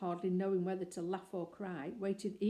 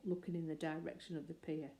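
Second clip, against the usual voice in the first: ordinary delivery, nothing marked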